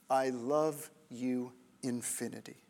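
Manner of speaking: soft, hushed declaration of love; tender whisper. Acted. God tells the slaves, hushed and tender. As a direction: hush it like a secret promise.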